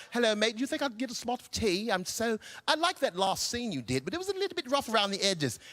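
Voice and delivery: quick, soft mock British accent. British actors chatting on set in a quick, soft British accent. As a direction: rattle it off like a polite Englishman. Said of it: "they're like".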